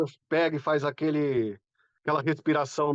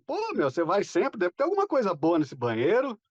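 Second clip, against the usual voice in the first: teasing teacher; 6 semitones higher than usual. Retold or imitated. Teacher teasing the bathroom student.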